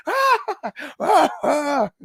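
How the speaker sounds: high squealing mock scream; hammed-up high-pitched shriek; schoolgirl scream. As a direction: squeal like a scared little schoolgirl. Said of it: "he screamed like a little schoolgirl".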